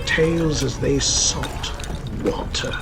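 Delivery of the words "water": plain reading voice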